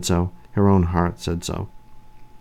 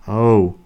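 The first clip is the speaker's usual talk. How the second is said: sharp gasp; 3 semitones higher, 5 dB louder than usual. A narrator's reading. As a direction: let out a sudden startled gasp. Bitten, pain blooming into pleasure.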